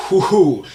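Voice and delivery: high, loud mock-drunk whoop; tipsy whoop. Imitating Shrek getting drunk.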